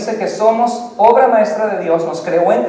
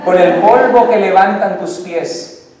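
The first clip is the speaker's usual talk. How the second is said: slow, louder, savoured line; romantic recital; 7 dB louder, slower than usual. Acted. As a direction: savour each word like a serenade. Poem to wife, slowed.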